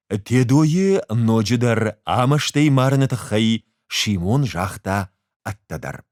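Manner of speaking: usual speaking voice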